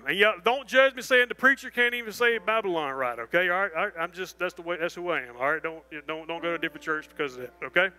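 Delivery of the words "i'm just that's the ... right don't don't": usual speaking voice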